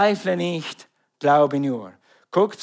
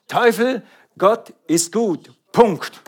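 loud, higher call at the devil; defiant shout; 6 semitones higher, 5 dB louder, slower than usual. Real, not acted. Preacher shouting defiance at the devil. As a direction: throw it at the enemy.

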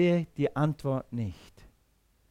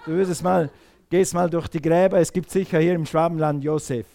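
usual speaking voice

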